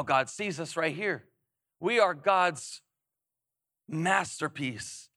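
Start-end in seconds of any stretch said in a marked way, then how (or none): 1.8-5.1 slow emphasis. Acted. Slowed emphasis on key verse.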